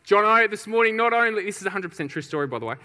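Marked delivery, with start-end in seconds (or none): 0.1-1.4 raised, louder mock bravado; intensified vow. Fired-up champion declares his plan to mate, raised bravado. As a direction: announce it big, working yourself up. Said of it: "intensifies what he wants to do".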